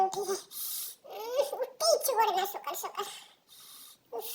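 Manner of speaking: drowsy mumbled echo; sleepily trailing off; sleepy fade. Acted. Sleepy child repeats, fading.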